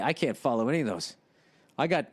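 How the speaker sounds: usual speaking voice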